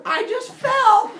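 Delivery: very high, loud, drawn-out "I just fell!"; imitating someone pretending to fall off a cliff; fake accident shout. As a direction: cry out a badly faked accident. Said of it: "you can go".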